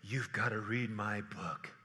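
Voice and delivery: hushed conspiratorial sales voice; false prophet sales pitch. Retold or imitated. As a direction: whisper it like a huckster selling fear. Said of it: "if I say".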